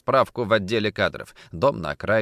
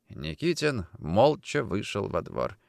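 ordinary delivery

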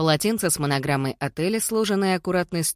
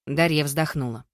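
ordinary delivery

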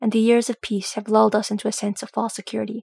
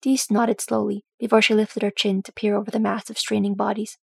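ordinary delivery

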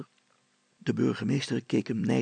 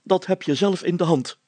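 high, loud self-important mayor voice; pompous mayor; 7 semitones higher, 8 dB louder than usual. A narrator's reading. Mayor threatening Brilstra with a fine, pompous and high. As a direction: lecture him like a puffed-up mayor.

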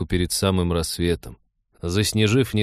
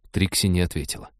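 usual speaking voice

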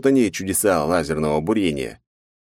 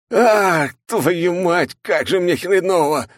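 louder, strained cry of pain; pained curse; 12 semitones higher, 4 dB louder, much slower than usual. Acted. Hero curses after crashing to the floor in pain. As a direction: swear through the hurt.

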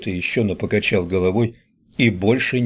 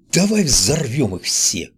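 excited shout; 4 semitones higher than usual. A narrator's reading. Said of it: "закричал Ричи вне себя от радости".

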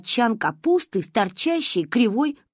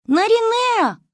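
high, loud call from afar; choking cry of a name; distant call; 13 semitones higher, 5 dB louder, slower than usual. A narrator's reading. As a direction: call out across the dark forest. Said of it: "кто-то звал меня вдалеке, захлёбываясь криком".

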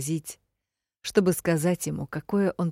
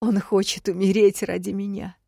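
raised, louder distressed voice; sobbed protest; 4 semitones higher, 5 dB louder than usual. A narrator's reading. Heroine realizes he will die for her, distressed and sobbing. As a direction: protest with a touch of a sob. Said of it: "всхлипнула я".

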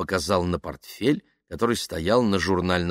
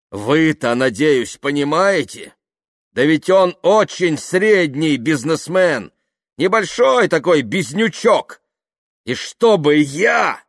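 loud, higher, scornful appeal; indignant; 9 semitones higher, 8 dB louder, slower than usual. Acted. Kaftanov belittling Yuklin to Dasha, indignant. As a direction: stretch the words with heated contempt.

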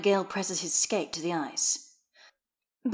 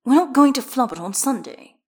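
louder, higher sharp retort; 6 semitones higher, 8 dB louder than usual. A narrator's reading. Skater rejects any talk of flubbing, sharply. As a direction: cut in sharply, voice raised. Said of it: "Astarion says sharply".

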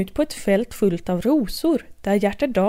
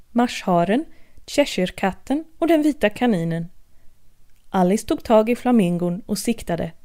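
ordinary delivery

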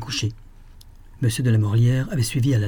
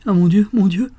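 high, louder cry of despair; desperate cry; 9 semitones higher, 5 dB louder than usual. A narrator's reading. A lost mother crying out in despair, high and loud. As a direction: wail it out as memory fails. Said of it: "s'écria-t-elle avec une sorte de désespoir".

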